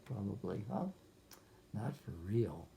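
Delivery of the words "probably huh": usual speaking voice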